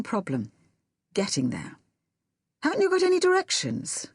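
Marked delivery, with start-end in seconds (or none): 2.6-4.1 much higher, hopeful question. A narrator's reading. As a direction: ask it hoping for a yes. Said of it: "I asked hopefully".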